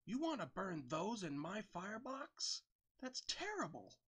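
high, slow outraged train engine; a scandalised engine protests; indignant engine voice. A narrator's reading. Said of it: "Ed snorted".

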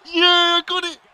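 loud high victory shout; real triumphant shout; triumphant yell. Real, not acted. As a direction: roar it like you just won. Winning the match.

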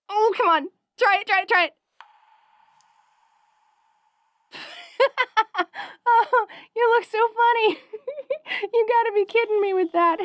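giggly high pleading and teasing; bubbly. Acted. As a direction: laugh through the words. Giggling at goat mask.

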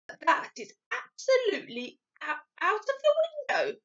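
lightly voiced giant, ruefully emphatic; BFG. BFG confiding his helplessness to Sophie, rueful giant voice. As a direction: lament with lively rises and falls.

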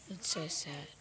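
soft whisper at the grave; hushed whisper at the kitten's burial. Real, not acted. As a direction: whisper it sadly. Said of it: "It's sad, isn't it?".

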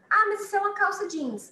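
high, loud student objection; imitating a student. Teacher imitates student asking about jeans. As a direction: play the surprised student. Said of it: "student voice quoted".

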